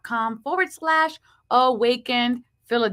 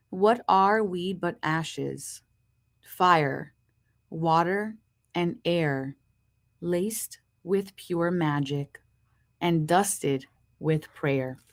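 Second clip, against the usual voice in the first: low, slow, hushed poem reading; poem recitation; 7 semitones lower, 4 dB quieter, much slower than usual. Acted. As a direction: savour each line of the verse. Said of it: "Here's the poem".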